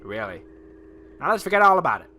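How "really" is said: much lower, soft falling really; flat confirmation. Acted. Player confirming to the governor, low flat reply. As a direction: confirm it flatly.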